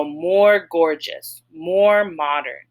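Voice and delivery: slow, careful example words; slow teaching demo. Shown as a demonstration. Teacher showing examples to students, slow and deliberate. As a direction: spell out each example slowly for the class. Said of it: "we just add the word more in front of it".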